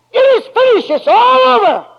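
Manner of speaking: very high, loud triumphant cry. A narrator's reading. Christ on the cross crying out to heaven, loud and very high. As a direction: cry it out as the last word from the cross. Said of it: "And he cried".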